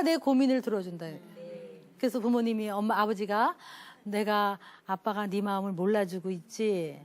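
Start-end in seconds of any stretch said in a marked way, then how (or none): 4.0-7.0 gentle father. Retold or imitated. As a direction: coax it out of him gently. Father gently speaks to troubled child.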